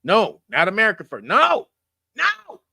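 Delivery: mock correcting the order loudly; rising no. Shown as a demonstration. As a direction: correct them with rising nos. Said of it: "gotta get the order right".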